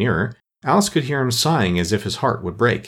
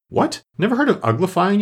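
high-pitched, astonished outburst; surprised exclamation; 11 semitones higher than usual. A narrator's reading. Griffin exclaims at Alice's ignorance of uglifying, astonished. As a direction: leap up in pitch with disbelief. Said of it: "lifted up both its paws in surprise".

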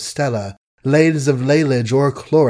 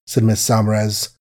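plain reading voice

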